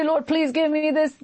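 mock pleading prayer, evenly intoned; lightly acted plea; crying out to God. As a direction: imitate someone begging the Lord. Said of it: "when you cry and say".

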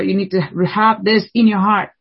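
ordinary delivery

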